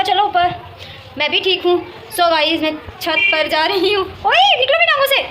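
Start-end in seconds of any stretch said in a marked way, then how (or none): 4.0-5.2 high-pitched scolding yelp; scolding the pet. Real, not acted. Scolding pet that bothers her. (ऊँची आवाज़ में डाँटते हुए)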